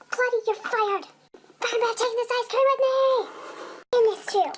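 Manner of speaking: high, loud acted fit of outrage; indignant outburst. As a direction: sound off in a high-pitched huff. Fired worker storms off shouting.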